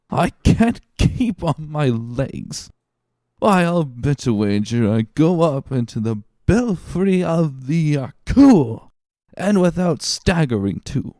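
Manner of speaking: put-on high, slow, slurred drunken pride; offended drunk. Drunk Caderousse boasting to Danglars, offended and slurred. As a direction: puff up with wounded tipsy dignity. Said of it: "offended dignity of a drunken man".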